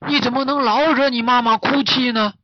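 high, loud character reproach; scolding master. Acted. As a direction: tell the fawn off for upsetting mother. 大师责备小鹿. (大师尖声责备)

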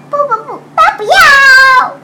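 high loud no no no; shouted refusal. Acted. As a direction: yell it out, all refused. Mouse refuses all.

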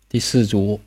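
normal talking voice

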